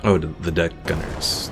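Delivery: usual speaking voice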